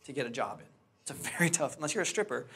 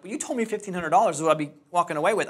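indignant protest; 3 semitones higher, 7 dB louder, faster than usual. Retold or imitated. Protesting to man withholding promised pay.

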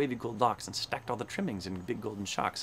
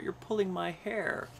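high, slow complaint; plaintive cry; 7 semitones higher than usual. A narrator's reading. Poor Hubert has his hair pulled, crying plaintively. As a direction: draw it out in a small, high cry. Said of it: "cried poor Hubert".

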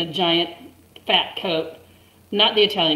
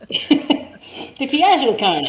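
drawn-out, higher mock Italian come-on; slow mock pickup line; 7 semitones higher, 5 dB louder, much slower than usual. Teller mocking the man's slow come-on line. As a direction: stretch it out like a cheesy flirt.

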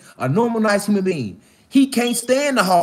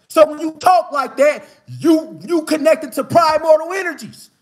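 fiery preaching; 6 semitones higher than usual. Real, not acted. Preaching rant builds to shout.

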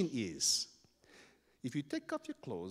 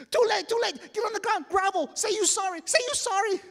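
very high, loud shrieked orders; acted terrified kid; hysterical panic; 10 dB louder than usual. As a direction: shriek it in terror. Keoki panics as marchers arrive.

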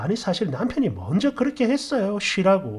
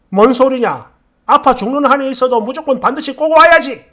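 loud, higher-pitched angry outburst; ranting father-in-law; 5 semitones higher, 10 dB louder than usual. Acted. Father-in-law insisting his sick daughter-in-law come, angry and loud. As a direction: play an irate father-in-law raising his voice. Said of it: "이렇게 난리치는 시부라는 인간".

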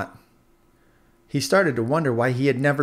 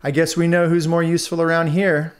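snickering mockery; 6 semitones higher, 5 dB louder than usual. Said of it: "snickering to each other".

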